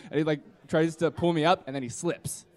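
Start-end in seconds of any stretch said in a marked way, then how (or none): none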